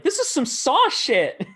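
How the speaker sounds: high, slow mock horror; mock horrified voice. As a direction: squeal it in mock disgust. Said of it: "And they're like".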